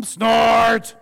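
a big shouted machine snort; loud snort. Acted. The snort answering the bird, a loud shouted snort. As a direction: blast the snort out.

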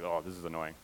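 low, soft, deflated grumble; retold annoyance. Pastor recalling the broken phone screen, mildly annoyed. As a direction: sigh it under your breath, mildly fed up. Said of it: "I was super annoyed".

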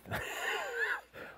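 airy squeaky laugh; a breathy high-pitched titter; high falsetto giggle. Acted. Preacher mocking the grinding Sadducees, squeaky giggle.

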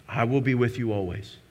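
hushed, tender "I will be with you always"; soft reassurance. Shown as a demonstration. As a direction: say it gently, like a promise whispered close. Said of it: "what does that mean?".

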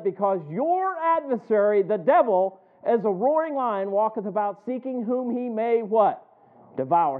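loud, rising pulpit cadence; preaching at full force. Acted. Pastor warning the congregation about Satan, loud preaching. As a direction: drive it home to the congregation.

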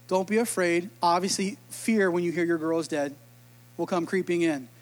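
ordinary talk

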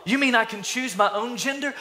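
high-pitched gullible amazement; mock wonder. Gullible follower of pop culture asking a question, high and mock amazed. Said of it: "some people, they, they think to be meek".

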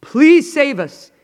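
loud, high, drawn-out begging; desperate plea. Acted. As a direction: cry out for rescue. Acts out disciples' desperate plea.

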